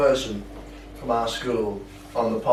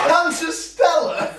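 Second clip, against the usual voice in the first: high, drawn-out laughing shout; real, loud squeal of astonishment; incredulous; 4 dB louder, much slower than usual. Real, not acted. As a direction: cry it out in amused disbelief. Laughing disbelief at friend's story.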